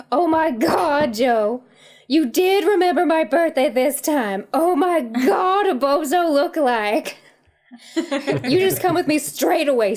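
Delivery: excited high Southern lady; gushing Southern belle voice; Southern accent. Acted. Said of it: "That spot-on Southern accent".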